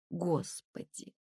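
тихий протяжный вздох отчаяния; протяни тихо и тоскливо; с тоской. A narrator's reading. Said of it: "сказала Лена с тоской". (тоскливо, вполголоса)

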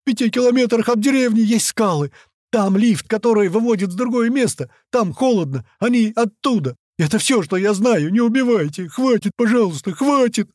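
high, loud panicked confession; terrified squealing. A narrator's reading. Stabbed captive telling everything to save himself, squealing terror. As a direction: squeal it all out, begging desperately. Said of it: "Визг Толстого перешёл на ультразвук".

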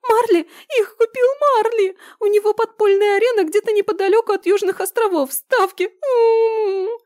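high squeaky howling cry; wailing. Acted. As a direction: wail it out in pain. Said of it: "провыл розовый".

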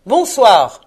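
loud, falling goodnight; curt dismissal. A narrator's reading. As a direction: shut him out with one forceful word. Scrooge congédie son neveu. (congédiement sec et fort)

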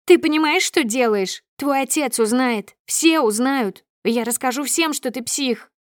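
high, agitated scolding voice; furious threat. A narrator's reading. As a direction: threaten to expose your husband. Furious wife threatens husband. (гневно, угрожающе, высоким голосом)

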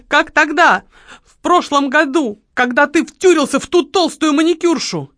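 high, loud outraged squeal; shrieking accusation. A narrator's reading. Lola accuses the marquis of flirting, shrieking indignantly. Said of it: "она заверещала".

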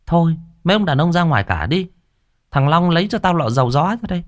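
put-on high, fast, brisk old neighbor giving orders; bossy neighbor woman. A narrator's reading. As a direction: snap out orders like a busy neighbor. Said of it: "Có bà hàng xóm nhanh miệng bảo".

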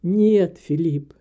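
firm protest, loud on each no; objecting. A narrator's reading. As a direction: push back emphatically, dropping low on the name. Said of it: "возразил он". (твёрдое возражение, с нажимом)

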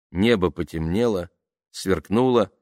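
usual speaking voice

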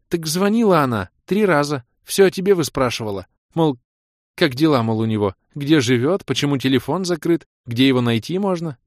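a mother rattling off someone's questions; insistent mother. Acted.

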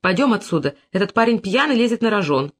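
ordinary delivery

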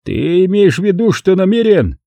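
a hint of a stunned drunk; lightly voiced astonished man; Brogan's disbelief.

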